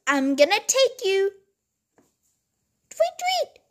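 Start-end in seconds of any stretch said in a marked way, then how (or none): 2.9-3.6 squeaky high chirps; high birdie tweets. Pretend. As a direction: chirp like a tiny bird. Stolen bird cries.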